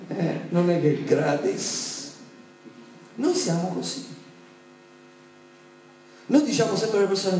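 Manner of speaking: mock greedy pastor.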